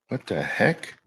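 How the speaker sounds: normal talking voice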